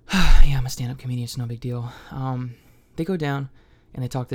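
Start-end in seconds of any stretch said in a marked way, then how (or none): none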